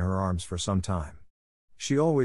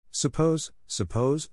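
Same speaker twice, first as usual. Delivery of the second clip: rising, unfinished, pitched up; drifting off; 7 semitones higher, slower than usual. A narrator's reading. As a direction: trail each word upward into sleep. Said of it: "her very weariness was good to her".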